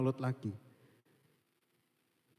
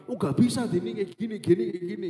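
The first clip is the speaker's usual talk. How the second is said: loud, high mock confrontation; imitating pushy arguing; 6 semitones higher, 5 dB louder than usual. Imitating a confrontational classmate telling Dini off, pushy. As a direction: snap back like a pushy classmate, mockingly. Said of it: "dia nyolot banget orangnya".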